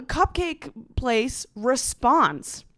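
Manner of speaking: ordinary delivery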